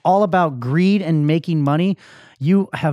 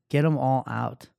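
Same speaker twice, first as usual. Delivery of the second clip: soft, subdued insistence; low, defeated order; 3 semitones lower, 6 dB quieter than usual. Retold or imitated. Crying man repeating himself to his cousin, low and defeated. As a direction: repeat the order quietly, drained.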